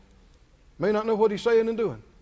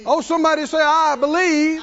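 preaching; 6 semitones higher, 5 dB louder than usual. Real, not acted. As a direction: lead the church in response. Preacher calls congregation response.

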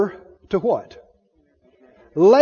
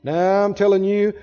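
normal talking voice

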